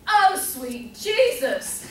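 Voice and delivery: loud, high mock yell, drawn out; mama yelling. Host mimics a mother for the player, yelling. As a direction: yell it slowly like an exasperated mama. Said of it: "your mama's voice yelling".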